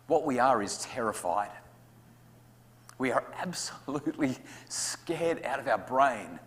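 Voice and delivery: usual speaking voice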